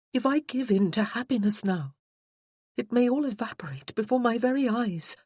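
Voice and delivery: a hint of a worried fiancée; Freya's voice. Freya explains her doubt to Josh, lightly voiced and anxious.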